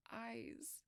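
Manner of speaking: hushed, breathy whisper throughout; a frightened secret whispered to the doctor; whispered confession. Acted. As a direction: murmur the story as if guards could hear.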